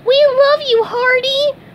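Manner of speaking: very high, loud kiddie voice; high-pitched adoring cheer; little fishes replying. A narrator's reading. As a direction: squeal it like adoring small children. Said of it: "the little fishes replied".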